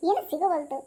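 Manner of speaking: ordinary talk, nothing marked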